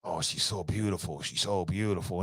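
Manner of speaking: low, soft, smitten creep; mimicking his friend John, low and soft, swooning. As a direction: murmur it low like a lovestruck stalker. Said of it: "In that John voice".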